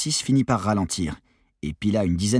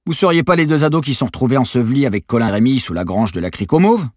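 loud question, jumping higher at the end; sudden realisation; 5 semitones higher, 9 dB louder than usual. A narrator's reading. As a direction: blurt it out as it clicks. Journalist suddenly realises who they are.

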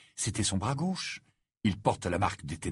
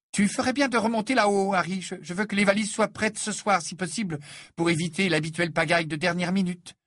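brisk Mrs Weasley; 9 semitones higher than usual. Acted. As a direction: play the busy mother laying out the plan. Mrs Weasley voiced by narrator.

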